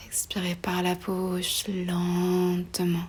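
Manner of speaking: breathy, stretched-out words like a long exhale; drawn-out slow. Real, not acted. Yoga teacher guiding a slow breath out, drawn-out and soothing. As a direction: draw the last word out very slowly.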